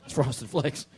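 talking through a mouthful of cereal; mouth full. Real, not acted. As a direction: say it while chewing flakes. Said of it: "talk with your mouth full".

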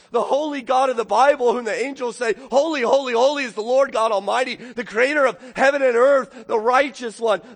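loud, high fervent preaching; impassioned preaching. Real, not acted. Preacher proclaims God's holiness to the church, fervent. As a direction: build it like a proclamation.

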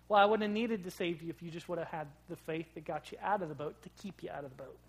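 ordinary talk, nothing marked

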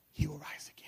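whisper. Acted. Martha's quiet theological answer.

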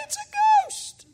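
shrieking way up high, slow; panicked disciples screaming at a shape on the water; terrified cry. A narrator's reading. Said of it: "they cried out".

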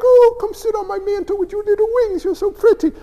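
high sing-song cooing; mock sweet little angel voice; cutesy angel. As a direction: gush like a dainty cherub. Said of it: "They're not like".

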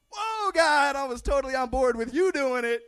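loud, high alarmed whoa; startled protest. Pretend. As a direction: recoil in shock. Moses panics at his calling.

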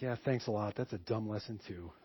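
sulky sarcasm. Retold or imitated. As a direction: mutter "Yeah, thanks a lot" low and sulky. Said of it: "I didn't say that".

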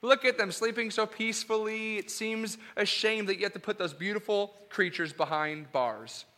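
sweet doting cat-lover voice; cat lady. Acted. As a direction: coo over the lions like a doting cat owner. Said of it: "this cat lady".

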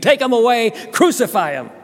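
high, loud screaming demand; mob scream. Acted. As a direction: scream it as an enraged crowd. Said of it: "They screamed".

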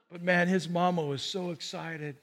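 ordinary talk

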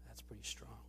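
whispered, almost to himself; a soft whispered comment after the verse; hushed aside. Real, not acted. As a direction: let it drop to a breath. Aside after reading scripture.

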